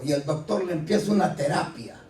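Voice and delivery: ordinary talk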